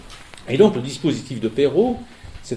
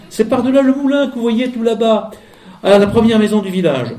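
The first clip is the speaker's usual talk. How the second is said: voix de fillette; 9 semitones higher, 6 dB louder than usual. Acted. La fillette indique le chemin.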